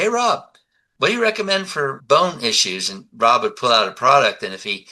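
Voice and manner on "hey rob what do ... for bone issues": mock-innocent, high-pitched question; feigned casual inquiry. Customer testing the health store owner, feigned casual inquiry.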